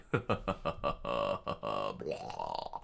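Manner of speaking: deep soft villain laugh; low evil chuckle. Acted. Villain laughs at the bleeding swordsman, low soft evil chuckle.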